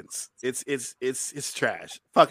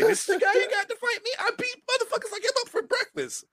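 much higher, cocky villain voice, a bit fast at first; Syndrome taunting; faster than usual. Retold or imitated. Syndrome mocking the weak opponent he must fight, high cocky taunt. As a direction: sneer like a smug cartoon villain.

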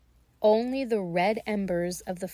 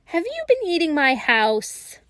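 put-on high, loud old-crone voice; croaky old witch; 8 semitones higher, 6 dB louder than usual. Old woman at the door scolds the children, high crone voice. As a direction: accuse them like a cranky old hag. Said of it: "she croaked".

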